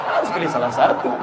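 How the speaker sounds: ordinary talk, nothing marked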